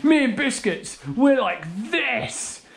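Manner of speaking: high, slow mocking impression; mocking imitation. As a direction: parrot his words back to tease. Mocking brother's line.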